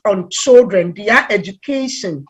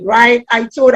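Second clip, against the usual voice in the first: ordinary delivery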